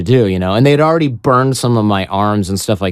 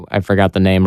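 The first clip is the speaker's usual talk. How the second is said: ordinary talk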